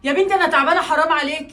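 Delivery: a loud, hurt plea for mercy; loud desperate begging; loud pleading. Acted. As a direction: cry out loud, begging her daughter. Mother pleading, being thrown out.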